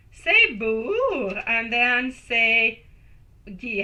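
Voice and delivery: "bourg" and "ghee" spelled out slowly as syllables; teacher slowly drilling "bourg" then "ghee" one piece at a time; teaching a pronunciation. Shown as a demonstration. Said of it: "You gotta say it in three, three phases".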